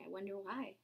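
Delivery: plain reading voice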